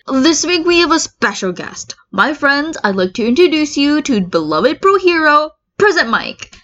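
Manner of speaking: excited host intro. Acted. Podcast host introduces guest.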